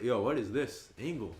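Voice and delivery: ordinary talk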